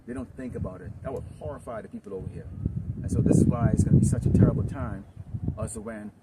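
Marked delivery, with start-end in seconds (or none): 3.0-6.1 loud, forceful preaching at full volume; preaching shout. Real, not acted. Preacher shouting a warning to the men, loud. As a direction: thunder the warning at the congregation.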